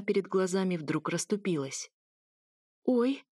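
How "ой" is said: frightened whimper. A narrator's reading. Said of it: "заскулила от страха".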